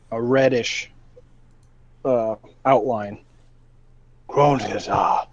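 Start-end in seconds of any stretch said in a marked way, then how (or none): 4.3-5.3 booming voice. Acted. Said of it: "in a very booming voice".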